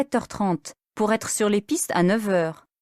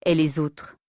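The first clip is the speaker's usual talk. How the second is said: usual speaking voice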